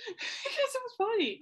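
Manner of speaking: high helpless giggling through the words; laughing so hard the words squeak out high. Real, not acted. Laughing at a Monkees joke while telling it, giggling. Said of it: "see, I'm giggling right now".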